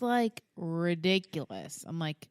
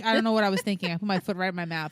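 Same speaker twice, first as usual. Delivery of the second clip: ordinary talk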